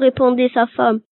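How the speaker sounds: ordinary delivery, nothing marked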